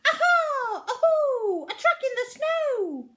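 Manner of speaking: put-on high, loud triumphant child creature cry; a small monster thrilled at her discovery; very high and loud excited Aha; excited little Gruffalo's child. Child finds track.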